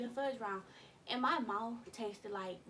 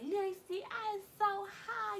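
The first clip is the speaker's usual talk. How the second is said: high anxious call; 9 semitones higher, slower than usual. Retold or imitated. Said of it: "I called her, like".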